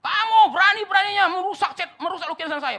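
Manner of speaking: high, very loud outraged shouting; furious. Acted. House owner confronting painter over ruined painting, furious. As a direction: explode at the culprit in acted rage. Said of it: "orang yang punya rumah ini marah besar".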